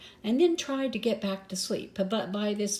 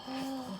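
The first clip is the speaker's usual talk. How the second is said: sharp gasp; 6 semitones higher, 11 dB quieter than usual. Acted. Waterfall suspense.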